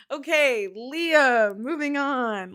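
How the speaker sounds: bursts out loud to move on; loudly hurrying the reading along; booming "moving on" to the chat. Real, not acted.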